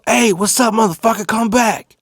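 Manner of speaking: high-pitched angry call after someone; calling out angrily. Retold or imitated. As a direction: call out sharply to someone getting away. Calls after thief across street.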